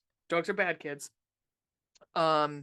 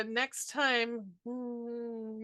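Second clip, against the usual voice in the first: usual speaking voice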